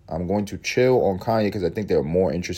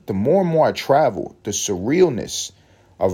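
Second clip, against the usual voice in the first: ordinary delivery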